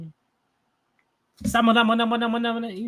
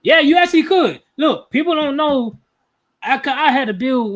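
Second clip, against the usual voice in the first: high, loud and fired up; selling it with real energy; hyped; 7 semitones higher, 6 dB louder than usual. Real, not acted. Hyping the full reading to viewers, excited.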